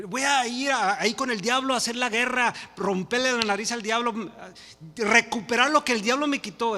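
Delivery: loud, high-pitched mimicry of zealous boasting; mock battle cry. Preacher mimics believers off to fight the devil, loud mock bravado. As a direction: imitate a fired-up believer declaring war, loudly.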